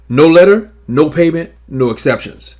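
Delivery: firm list, punching each "no" as a final rule; emphatic triple stress. Shown as a demonstration. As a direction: hammer every "no" in "No letter, no payment, no exceptions". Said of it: "I want to emphasize an important note".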